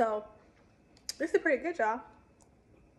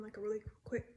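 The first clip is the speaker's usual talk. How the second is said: usual speaking voice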